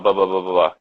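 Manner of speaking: "blah" reduced to a string of "buh"; low, repeated "blah" with neutral schwa vowels; schwa sounds. Shown as a demonstration. Teacher illustrating lots of schwa, low "buh-buh" babble for "blah". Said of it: "a lot of schwa".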